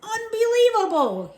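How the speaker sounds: outraged high shout. A narrator's reading. Uncle shouting at the soldier, outraged and loud. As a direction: explode in disbelief. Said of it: "he shouted".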